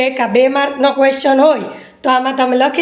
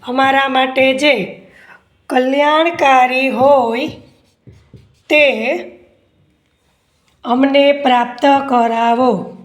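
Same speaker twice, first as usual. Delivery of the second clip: word by word with long pauses; slow dictation; slower than usual. Acted. Teacher dictating an answer to students, slow dictation. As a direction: dictate slowly, leaving time to write.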